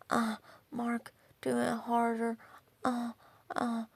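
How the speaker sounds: half-hearted pretend moaning; mock moaning. Mimics overheard bedroom moans.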